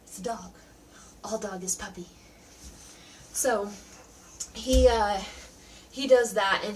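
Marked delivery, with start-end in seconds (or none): 0.0-2.1 muttered aside. Real, not acted.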